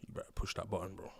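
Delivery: soft, low-key threat; hushed threat. Retold or imitated. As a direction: warn him quietly across the courtroom. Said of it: "so he's whispering".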